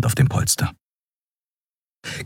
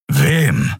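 growled. A narrator's reading. Keru questioning Andriel, growled. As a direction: growl it curtly with a touch of menace. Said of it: "knurrte Keru".

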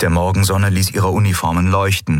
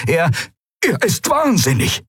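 much higher, snapped accusation; 12 semitones higher than usual. A narrator's reading. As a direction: snap it out, outraged. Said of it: "schnappte Andalok". (scharf geschnappt)

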